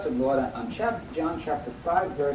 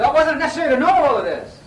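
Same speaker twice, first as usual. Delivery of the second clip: loud, high exasperated reproach; raised-voice exasperation; acted rebuke; 12 semitones higher, 10 dB louder than usual. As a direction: throw it out as an indignant scolding.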